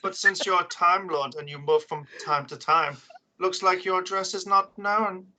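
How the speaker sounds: a petty bureaucrat villain savouring every word; Postmaster villain voice. Acted.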